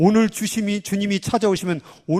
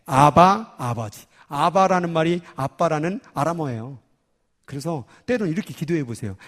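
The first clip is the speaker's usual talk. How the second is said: normal talking voice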